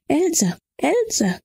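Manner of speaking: high, urgent name-calling; acted anxious call; calling to someone. Relative calls the fainted girl.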